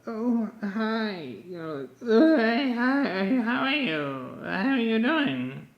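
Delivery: high, mocking mouth-full greeting; talking with food in mouth. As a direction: imitate someone chatting through a mouthful. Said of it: "some people talk like they have, like, a few pieces of food".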